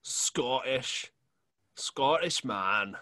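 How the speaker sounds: mock Scottish accent, slow at the end; a lightly put-on Scottish brogue. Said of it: "England and Scotland, I can do".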